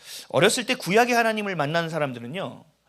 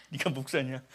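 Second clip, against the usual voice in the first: self-mockery; 5 semitones lower, 5 dB quieter, slower than usual. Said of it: "이런 생각도 합니다".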